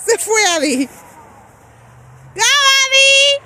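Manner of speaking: long high cheering shout. Real, not acted. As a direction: cheer them down the hill. Cheering child on sled.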